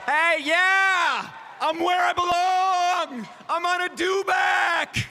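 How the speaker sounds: high, drawn-out celebratory shout; excited whoop. Real, not acted. Host opening the live show atop a dewback, excited high shout. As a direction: holler it out, stretching the words.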